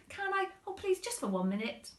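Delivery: put-on high, fast pleading boy; begging child. Lester begging his mum, high fast pleading.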